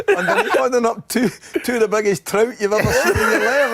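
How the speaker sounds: loud, high, through laughter; laughing excitedly. Real, not acted. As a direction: blurt out the absurd detail, cracking up. Cracking up at the trout image.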